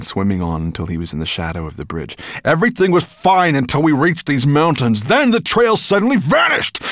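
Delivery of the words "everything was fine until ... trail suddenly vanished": high, loud dragon rant, rising; furious. A narrator's reading. Dragon whose trail vanished in the mountains, furious. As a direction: rage about the lost trail.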